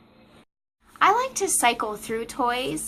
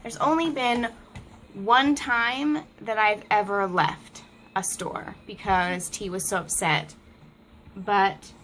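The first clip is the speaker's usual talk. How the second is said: ordinary talk, nothing marked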